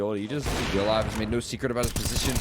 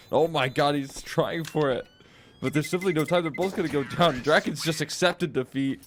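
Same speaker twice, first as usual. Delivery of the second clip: excited; 4 semitones higher than usual. Real, not acted. Caster hypes a clutch attempt.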